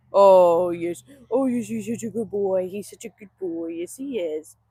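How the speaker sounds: lavish low, affectionate praise on a good dog; doting dog talk. Real, not acted.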